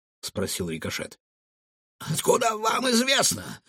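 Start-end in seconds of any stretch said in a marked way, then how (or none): 2.1-3.6 high-pitched puzzled question; surprised. A narrator's reading. Cook asks how they know, surprised. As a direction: ask in astonishment, pitch raised. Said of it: "удивился повар".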